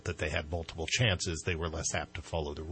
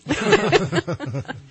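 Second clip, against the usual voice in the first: big high burst of laughter; loud laugh; 14 dB louder than usual. Real, not acted. Host laughs after joke.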